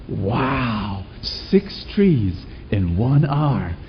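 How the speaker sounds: slow, hushed, awed wow; amazed wow. Acted. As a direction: marvel at it quietly. Farmer amazed at saw.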